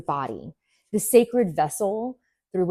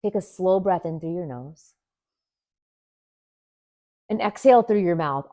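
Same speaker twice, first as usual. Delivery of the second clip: ordinary talk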